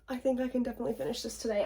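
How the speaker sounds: fast, whispered with laughter; giggly whisper. Real, not acted. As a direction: giggle it under your breath.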